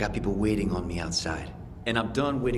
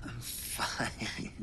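weak whisper; 10 dB quieter than usual. Acted. Injured man answers weakly.

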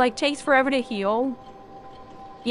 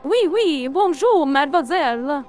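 mock French. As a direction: play a caricature French charmer. Joke switch to caricature French.

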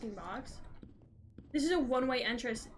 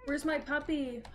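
ordinary delivery

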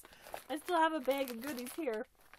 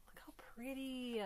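soft awed whisper; 6 dB quieter than usual. Real, not acted. Gazing at the doll, awed whisper. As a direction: breathe it in wonder.

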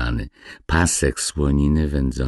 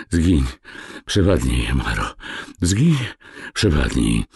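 ordinary delivery, nothing marked

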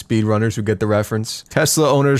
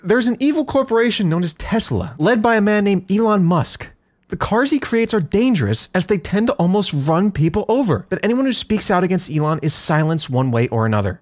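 higher, conspiratorial telling; high voiced Velvet; 8 semitones higher than usual. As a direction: lay out the plot high and sly.

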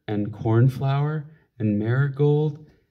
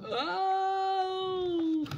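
very high, soft amazed oh; surprised squeal; 10 dB quieter than usual. Acted. Parent marveling as the child reads, very high soft oh. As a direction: gasp out a tiny delighted oh.

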